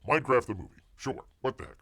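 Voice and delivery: ordinary talk, nothing marked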